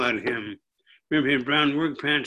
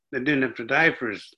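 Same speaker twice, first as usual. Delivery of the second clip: ordinary talk, nothing marked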